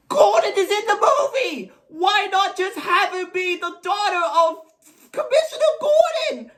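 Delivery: high, indignant shouting that peaks at the end; exasperated rant. Acted. Critic objecting to Barbara not being Gordon's daughter, exasperated. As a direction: protest in disbelief, voice jumping up. Ranting at a plot change.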